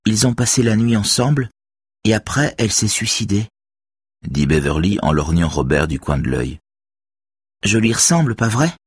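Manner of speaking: put-on teen girl voice. A narrator's reading. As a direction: voice the young girl defending him. Beverly's voice.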